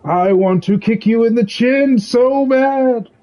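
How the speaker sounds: a hammed-up cry of frustration, slow on the second line; mock outburst. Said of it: "I say".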